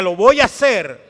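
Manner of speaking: a bold, loud vow from the pulpit; loud declaration. Shown as a demonstration.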